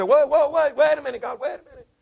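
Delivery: high, urgent protest. Retold or imitated. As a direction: cry out like an objecting crowd.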